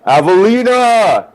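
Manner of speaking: full-throated, high-pitched yell of a name; calling out at the top of the voice. Acted. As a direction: yell it long and loud across a crowd. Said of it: "just yells".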